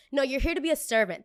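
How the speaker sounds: scornful customer. Retold or imitated. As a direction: cut in loudly as a haughty diner. Imitates customer snapping back.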